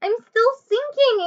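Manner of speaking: high, slow, drawn-out wail. Acted. Annie sinking in mud, wailing high and slow to Jack. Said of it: "Annie wailed".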